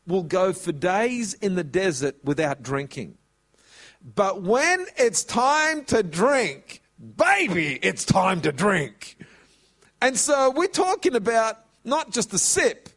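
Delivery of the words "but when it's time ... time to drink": put-on slow, loud and drawn out with a punchy baby; emphatic preaching. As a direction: lean hard into when it's time to drink.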